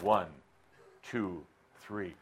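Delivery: ordinary delivery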